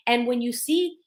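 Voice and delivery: ordinary talk, nothing marked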